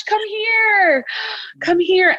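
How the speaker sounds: high-pitched coaxing of a crying puppy; cooing comfort. Acted. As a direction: call the little one over with a high, tender coo. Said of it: "imagine comforting this baby, this puppy".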